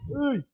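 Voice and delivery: short high "ui" of back pain; sudden wince of pain; pained yelp. Real, not acted. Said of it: "Ai, minhas costas aqui".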